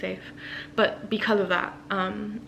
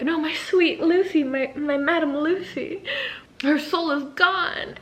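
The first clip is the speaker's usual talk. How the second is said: hammed-up grief; 8 semitones higher than usual. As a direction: ham up the heartbroken cry. Grieving men voiced in retelling.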